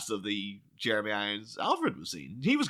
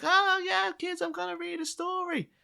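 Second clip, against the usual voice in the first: much higher, gushing singsong; chirpy kids' TV host voice; hammed-up children's presenter; over-eager presenter. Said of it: "she was like".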